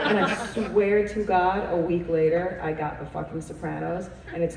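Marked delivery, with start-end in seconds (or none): none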